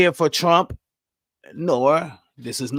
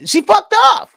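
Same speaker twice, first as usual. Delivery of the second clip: much higher, louder outburst; exasperated shout; 8 dB louder than usual. Real, not acted. Ranting to viewers about the candidate, sudden exasperated shout. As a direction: blurt it out at full volume.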